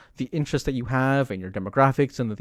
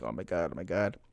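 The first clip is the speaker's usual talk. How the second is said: dismayed mutter; 6 semitones lower, 10 dB quieter, faster than usual. Retold or imitated. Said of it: "I was just like".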